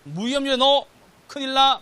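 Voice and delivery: loud, high alarmed orders; imitated wife's urgent nagging; scolding wife. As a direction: warn your husband off risk, loudly. Wife scolding him, acted out.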